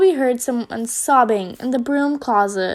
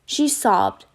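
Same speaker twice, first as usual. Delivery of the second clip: ordinary delivery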